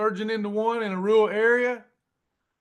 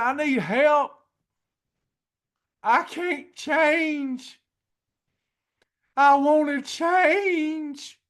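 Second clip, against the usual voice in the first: desperate prayer; 7 semitones higher, much slower than usual. Retold or imitated. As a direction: plead with God, voice straining upward. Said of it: "And I said".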